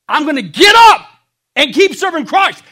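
loud, high declaration; defiant shout. Acted. Preacher declaring to the congregation he will keep serving Christ, loud and defiant. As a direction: shout it out in triumph.

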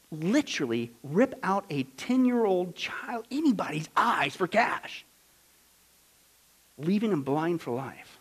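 slow whisper of horror; whispered horror at the evil; hushed whisper. Acted. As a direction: breathe the words out in disbelief. Hushed outrage.

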